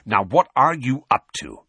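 suspicious master losing patience; impatient. A narrator's reading. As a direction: demand an answer impatiently. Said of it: "said Sirius impatiently".

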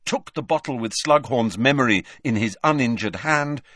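plain reading voice

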